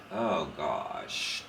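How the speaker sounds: usual speaking voice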